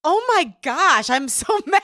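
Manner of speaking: mock outrage breaking into laughter; laughing outrage. Real, not acted. Host reacting to the tent story, laughing in mock outrage. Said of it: "reacting to story".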